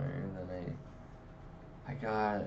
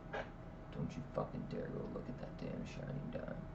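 whispered, menacing warning; silent-look threat whispered; 13 dB quieter than usual. Retold or imitated. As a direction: hiss it under your breath. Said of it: "like a look".

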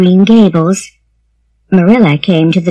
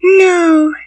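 put-on high, downcast little-girl voice; sad child; 10 semitones higher than usual. A narrator's reading. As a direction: say it sadly as a small girl. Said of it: "said the child sadly".

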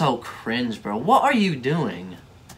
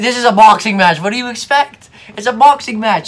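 higher and louder exasperated outburst; 8 semitones higher, 9 dB louder than usual. Real, not acted. Reacting to a boxer complaining she got hit, exasperated shout. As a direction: rant like it's obvious.

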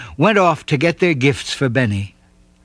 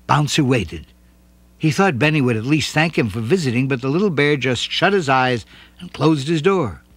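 ordinary delivery

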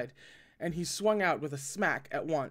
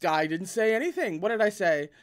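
playing dumb; 3 semitones higher, 7 dB louder than usual. A narrator's reading. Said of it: "play dumb".

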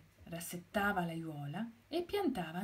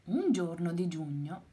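ordinary delivery

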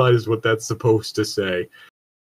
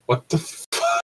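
squeaky startled what the fuck, much higher; shocked; 4 dB quieter than usual. Real, not acted. Friend blurts out shock at the joke, high and startled. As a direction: caught totally off guard. Shocked reaction to the sad line.

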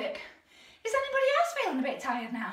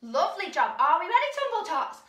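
normal talking voice

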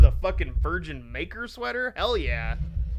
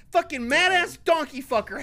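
yelling to myself; 6 semitones higher than usual. Real, not acted. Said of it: "I'm fucking yelling to myself".

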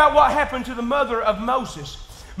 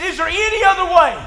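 louder, higher anguished plea; pleading Jesus; 7 semitones higher, 7 dB louder than usual. Retold or imitated. Pastor voicing Jesus begging the Father, louder anguished plea. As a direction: cry it out to the Father.

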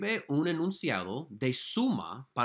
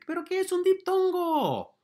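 quizzing question; 3 dB louder than usual. Acted. Teacher poses question dramatically.

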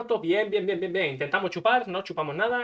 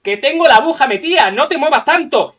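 loud scolding; 4 semitones higher, 8 dB louder than usual. Retold or imitated. Scolding the nurse mid blood draw.

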